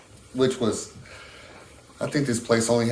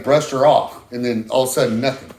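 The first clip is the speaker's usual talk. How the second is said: ordinary talk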